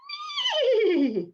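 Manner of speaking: high, loud whinnying laugh; horse laugh. Acted. Horse laughing at the tumbling rabbit. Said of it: "laughed the horse".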